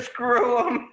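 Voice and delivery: laughing as he repeats it. Real, not acted. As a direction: echo the joke through a laugh. Laughing agreement with a joke.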